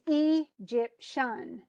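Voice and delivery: Egyptian spelled out slowly as EE, jip, SHUN; slow, separated syllables in Egyptian, EE-jip-SHUN; wrong stress. Shown as a demonstration. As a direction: demonstrate Egyptian with stress on first and third syllables. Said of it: "the first and third syllables".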